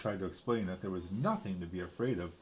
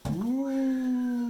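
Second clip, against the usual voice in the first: high hollow woo; wind wail; 7 dB louder than usual. Shown as a demonstration. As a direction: blow a ghostly woo. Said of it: "the sound was just like".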